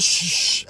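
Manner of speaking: acted "shh, shh"; sharp voiceless shush; urgent shushing. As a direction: hush someone beside you during a call. Hushing someone during a call.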